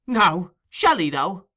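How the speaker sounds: eager cry. Acted. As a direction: ask it eagerly, cheered up. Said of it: "cried Charlie Bates".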